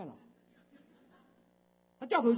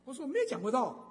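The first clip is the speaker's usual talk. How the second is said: loud, high mock protest of 我没有讲过道; half-joking raised voice insisting 我没有讲过道; playfully loud denial with 有 stressed; 7 semitones higher, 8 dB louder than usual. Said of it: "好，我是开玩笑".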